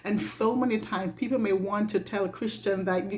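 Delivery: ordinary talk, nothing marked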